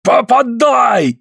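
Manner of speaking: high, stretched shout of command; yelling. A narrator's reading. Suль goads Andrei to strike, yelling. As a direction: yell it high, dragging out the end. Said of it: "орёт Суль".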